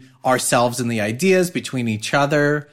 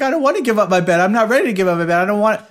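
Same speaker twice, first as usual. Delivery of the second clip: put-on higher, louder upset child's whine; imitating crying child; 7 semitones higher, 4 dB louder, much faster than usual. Dad imitating upset daughter refusing to give up bed, teary whine. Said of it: "started crying".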